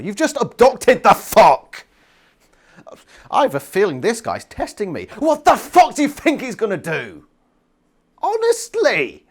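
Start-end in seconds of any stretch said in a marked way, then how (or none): 0.0-1.9 loud, high, drawn-out outburst; outraged. Real, not acted. Outraged reaction to the character's thinking. 5.2-9.3 high, loud exasperated rant. Real, not acted. Exasperated rant at the book's hero.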